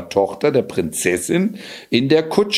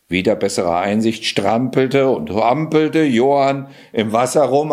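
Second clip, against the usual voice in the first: usual speaking voice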